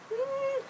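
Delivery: high, quiet doubtful hmm; a soft unconvinced hmm; skeptical hum. Retold or imitated. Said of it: "And I was like".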